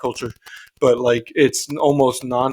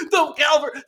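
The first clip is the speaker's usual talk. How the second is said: high, laughing through the name; laughing reveal; 12 semitones higher than usual. Retold or imitated. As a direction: blurt it out, cracking up. Retold cameo reveal, laughing.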